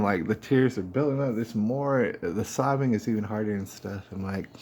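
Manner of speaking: ordinary delivery, nothing marked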